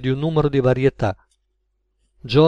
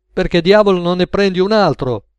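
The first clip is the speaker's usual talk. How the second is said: raised, louder irritated question; higher, exasperated demand; annoyed customer; 6 semitones higher, 5 dB louder than usual. A narrator's reading. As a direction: voice a customer losing patience.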